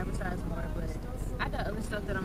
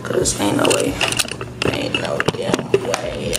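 deep whisper; 14 semitones lower, 5 dB louder, slower than usual. Real, not acted.

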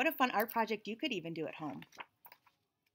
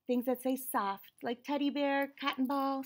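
usual speaking voice

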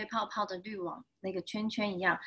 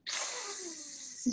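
long hissing spray; soft sustained "pssh" of spraying water; water-jet sound effect; 5 semitones higher, 10 dB quieter than usual. Acted. Storyteller voices the water gun firing, long hiss.

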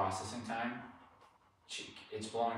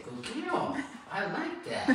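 much higher, sing-song praise; high dog praise; slower than usual. Real, not acted. Trainer praises Maggie in high baby talk. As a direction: lift into a squeaky praise voice.

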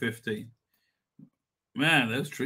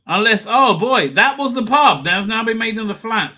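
dismayed realization; 6 semitones higher, 10 dB louder than usual. Real, not acted. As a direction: realise with a jolt the pub is gone. Realises the pub is gone.

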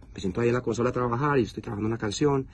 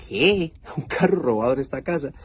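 higher, disbelieving "what?" with a laugh; shocked disbelief; 4 semitones higher than usual. Retold or imitated. Incredulous reply to police officer. (incrédulo, sorprendido y riendo)

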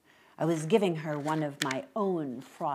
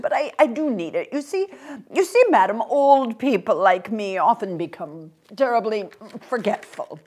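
put-on higher, louder, flustered old man's voice; hesitant little old gentleman; 5 semitones higher, 7 dB louder than usual. Old man asks a stranger for help, flustered and polite. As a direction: plead politely, stumbling over small restarts.

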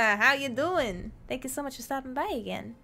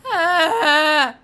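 long, high, loud "aah"; startled open-throated scream; loud yell; 4 semitones higher, 14 dB louder than usual. Real, not acted. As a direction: cry out loudly and hold it. Startled during play.

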